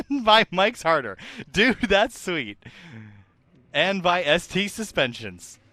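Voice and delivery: lightly hyped sponsor read; a touch of big announcer. Acted. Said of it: "And now comes announcer voice time".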